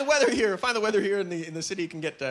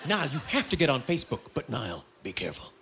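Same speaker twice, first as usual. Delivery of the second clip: low, softer, mock-solemn friend; grave warning; 6 semitones lower, 6 dB quieter than usual. Friends warning him about Facebook, mock grave. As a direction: lower it into a hushed mock caution.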